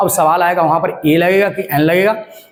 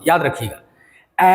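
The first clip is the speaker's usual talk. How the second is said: ordinary talk, nothing marked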